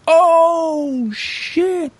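high, mock-shocked "oh shit"; retold gasp of shock, pitched high; shocked reaction. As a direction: jump way up in alarm. His own shocked reaction, retold.

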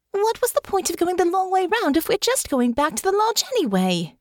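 put-on high young woman's voice, exasperated; fed-up heroine protesting; Honor, exasperated. A narrator's reading.

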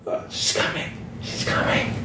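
hushed, ominous whisper; voiceless, fearful hiss; whispered warning. Acted. Character warns of someone coming.

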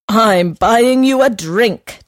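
with authority. A narrator's reading. Horse insists on paying, with authority. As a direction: lay it down like the boss. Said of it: "declared the horse with authority".

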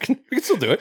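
high and laughing. Real, not acted. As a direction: squeak it out while laughing.

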